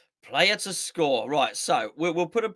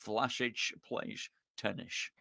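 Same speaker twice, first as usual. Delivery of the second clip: "Vlasic plays tennis" as "Vlashic playsh tennish", lower, much softer, slow Scottish; Sean Connery with SH; 7 semitones lower, 10 dB quieter, slower than usual. Retold or imitated. Repeating it for the chat with S and H, low slow Scottish Connery. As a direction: lean into the shushing Connery S. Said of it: "gotta be with the S and the H".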